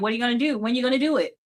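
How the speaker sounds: ordinary talk, nothing marked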